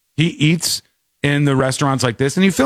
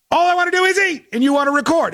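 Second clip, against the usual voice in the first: higher exasperated plea; 12 semitones higher, faster than usual. Retold or imitated. Couple arguing with filming YouTuber, higher exasperated. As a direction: voice jumps higher in frustration. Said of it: "their argument would probably be".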